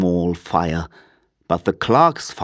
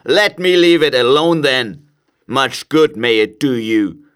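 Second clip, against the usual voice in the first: sarcastic miser, raised in pitch; crabby miser's high-pitched retort; testy Scrooge; 6 semitones higher, 4 dB louder than usual. A narrator's reading. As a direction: dismiss him with sour, emphatic scorn. Scrooge dismisses his nephew.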